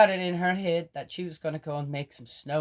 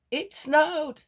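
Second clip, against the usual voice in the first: surprised; 14 semitones higher, 7 dB louder than usual. Real, not acted. Announcing snow with surprise.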